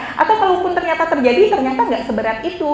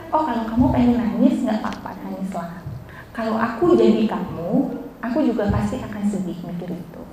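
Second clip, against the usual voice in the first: lower, softening reassurance; gentle consoling; 4 semitones lower, 3 dB quieter than usual. Acted. Teacher models comforting a crying friend, gentle consoling. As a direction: let the voice sink into tender permission.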